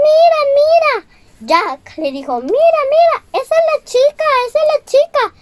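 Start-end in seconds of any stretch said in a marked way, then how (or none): 0.1-1.1 very high, loud mouse squeak; little mouse voice, much higher and louder; squeaky mouse. Acted. Mouse Jack points out the girl. 2.4-5.3 very high, loud mouse squeak; little mouse voice, much higher and louder; squeaky mouse. Acted. Mouse Jack points out the girl.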